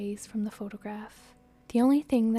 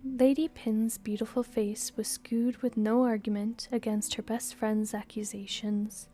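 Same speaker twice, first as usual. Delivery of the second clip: usual speaking voice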